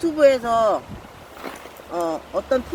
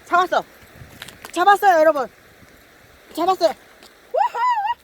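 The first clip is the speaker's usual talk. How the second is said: much higher, louder shouts of joy; excited triumph; 10 semitones higher, 7 dB louder than usual. Real, not acted. Angler finally landing a bass, excited triumph. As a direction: cheer the catch to viewers.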